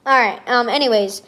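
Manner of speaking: ordinary delivery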